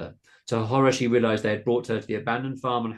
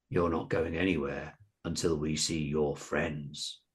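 low, slow menace; menacing; 5 semitones lower, 4 dB quieter, slower than usual. A narrator's reading. Biggest boy gripping Carolina's shoulder, menacing. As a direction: threaten slowly in a lowered voice. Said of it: "said menacingly".

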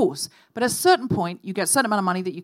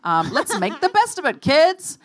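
cheery; 7 semitones higher, 3 dB louder than usual. Retold or imitated. Nanny cheering up kids.

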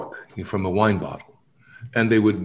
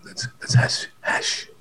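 secretive whisper about the hash; slower than usual. Retold or imitated. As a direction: lean in and whisper it. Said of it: "I go".

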